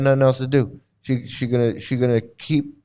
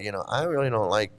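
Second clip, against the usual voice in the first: soft, lower voice of a worried mother; a hint of a tender mother; gentle mother; 7 semitones lower, 4 dB quieter, faster than usual. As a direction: say it quietly, like a caring mom. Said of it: "was like".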